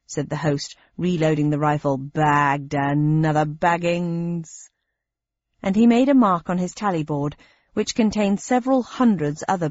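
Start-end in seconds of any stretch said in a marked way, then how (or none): 2.0-4.7 slow, lower smug hunter voice; gloating hunter. Acted. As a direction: gloat over the kill. Second host gloats after shooting.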